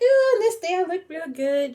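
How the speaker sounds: high, loud vain gushing; swooning over her own picture; self-admiring voice. Retold or imitated. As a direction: admire yourself in the photo. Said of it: "Like, like".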